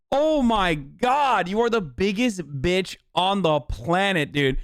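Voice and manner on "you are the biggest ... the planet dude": normal talking voice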